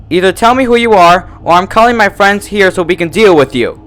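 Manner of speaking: high, loud furious demand; angry threat. A narrator's reading. Twilight threatens the unseen tormentor, furious. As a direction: threaten to call her friends.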